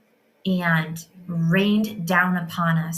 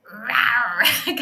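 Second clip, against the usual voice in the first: high cute cub growl; little lion cub roar; 6 semitones higher, 4 dB louder than usual. Retold or imitated. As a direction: play a tiny lion cub roaring. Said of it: "my daughter started to roar".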